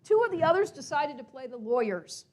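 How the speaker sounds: usual speaking voice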